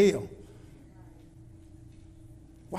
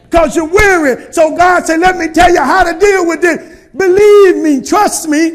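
higher, louder preacher build; full-force preaching; 6 semitones higher, 12 dB louder than usual. Acted. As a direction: preach it to the congregation.

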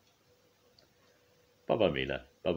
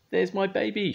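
put-on high, louder call for her baby; shouted; 4 dB louder than usual. A narrator's reading. A mother sees her baby on the road: high shout. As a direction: cry out across the road. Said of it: "shouted Missus Clutterbuck".

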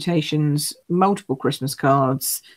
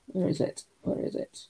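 whispered search under the breath; whispered to herself; 12 dB quieter than usual. Real, not acted. Host hunting for paper in her stash, whispering to herself. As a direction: whisper it while rummaging.

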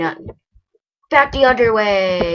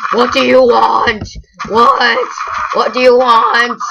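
loud, high taunts at a chicken; mock taunting; 6 semitones higher, 6 dB louder than usual. Player taunting a Minecraft chicken, loud and mocking. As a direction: goad it like a playground bully.

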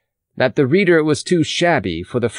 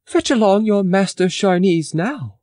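higher, lightly voiced proud young man; proud youth; 9 semitones higher than usual. A narrator's reading. Pitou admiring himself in the mirror, proudly voiced higher. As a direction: strut it, pleased with himself. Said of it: "He grinned approvingly and said".

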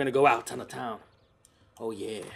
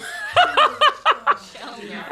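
long, high, loud cackle; gloating laugh; 4 dB louder than usual. Real, not acted. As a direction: cackle loudly at someone's misery. Gloats at mad green weenie.

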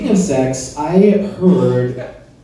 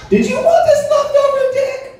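mock sweet offer. Offering to the ladies.

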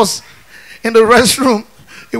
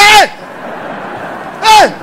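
shouting hey; 8 semitones higher than usual. Retold or imitated. Shouting at the schoolmate who stepped on him. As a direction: yell at her to stop and apologise.

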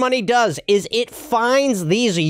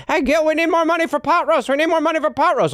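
nagging wife Alice; 9 semitones higher, faster than usual. Retold or imitated. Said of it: "Alice is up my A a little bit going".

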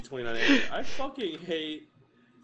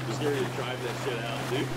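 ordinary talk

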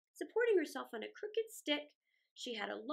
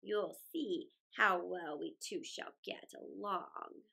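put-on lower, slow, doting old woman; lightly voiced old woman; 6 semitones lower, slower than usual. A narrator's reading. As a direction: promise it sweetly and slowly. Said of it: "said the old woman".

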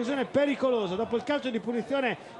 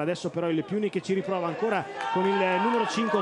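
usual speaking voice

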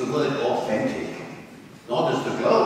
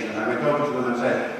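ordinary talk

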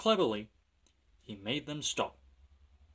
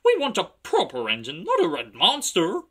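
loud, high taunt; jeering trucks; 7 semitones higher, 9 dB louder than usual. A narrator's reading. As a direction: complain loudly like rowdy trucks.

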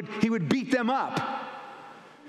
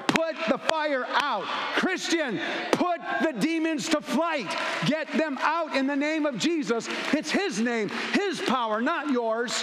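high, slow commanding preacher's call; rally the church like a fire chief; preaching at full force; 7 semitones higher than usual. Acted. Preaching climax.

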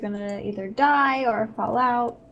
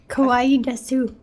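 excited anime fan; 2 semitones higher, 4 dB louder than usual. Pretend. Gasping anime cuteness.

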